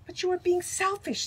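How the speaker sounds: put-on high, insistent child's reproach; indignant accusation. Leo tells Stillwater he is being selfish, indignant.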